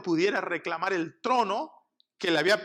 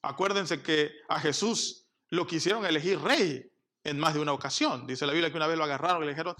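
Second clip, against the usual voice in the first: ordinary talk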